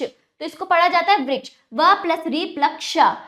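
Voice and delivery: ordinary delivery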